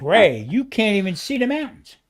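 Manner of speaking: loud, emphatic correction, pitch jumping up. Real, not acted. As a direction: stress how bad the haze is. Stressing the smog is bad.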